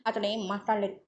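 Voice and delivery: ordinary delivery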